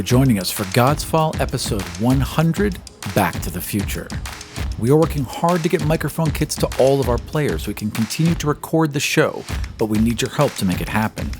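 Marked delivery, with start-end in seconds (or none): none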